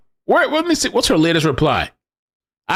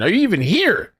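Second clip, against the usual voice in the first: ordinary talk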